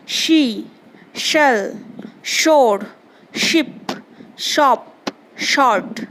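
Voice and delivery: each of शी, शेल, शोर begun with a clear hushing SH; SH sound demonstration. Shown as a demonstration. Teacher showing pupils how to read SH words, शी to शॉर्ट each with a distinct SH. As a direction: model the SH in शी through शॉर्ट, word by word. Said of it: "जैसे कि मैं पढ़ रही हूँ, सुनो".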